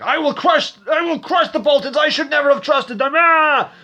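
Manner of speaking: high, full-throated furious yelling; over-the-top ranting shriek ending in a yell; enraged shouting. Retold or imitated. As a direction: scream it in a hammed-up rage. Voices Cersei raging, acted quote.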